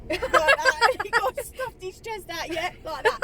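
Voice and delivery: laughing while retelling; laughing as she recalls the copper. Real, not acted. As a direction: giggle through the words.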